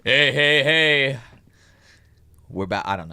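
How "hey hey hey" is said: loud high DJ hey; radio voice. Pretend. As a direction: call it out like a DJ. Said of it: "what's your radio voice".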